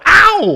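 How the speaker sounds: playful pained ow; mock yelp of pain. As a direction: yelp as if burned by something hot. Mock pain at hot joke.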